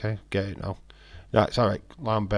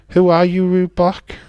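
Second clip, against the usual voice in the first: much louder and higher, calling out; loud callout; 9 semitones higher, 11 dB louder than usual. Real, not acted. Streamer calling out a rude chatter, loud and high. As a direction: yell the question at the chatter.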